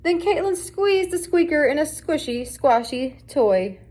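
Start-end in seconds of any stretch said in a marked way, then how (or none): none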